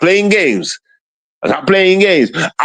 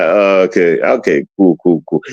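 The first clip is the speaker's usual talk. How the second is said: retold dismissal; 9 semitones lower than usual. Retells his dismissive reaction.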